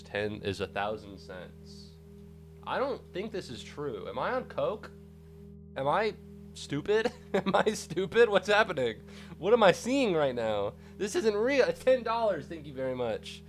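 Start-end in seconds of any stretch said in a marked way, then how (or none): none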